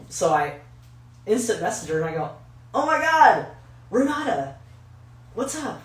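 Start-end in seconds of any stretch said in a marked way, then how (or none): 2.7-5.8 high, loud retold hello to an old friend; hammed-up high-pitched excitement; excited greeting. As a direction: greet a long-lost pal with delight.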